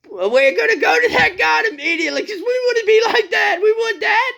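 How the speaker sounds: the crowd yelling their choice of god; shouting crowd. Acted. Preacher voicing the eager crowd, shouting.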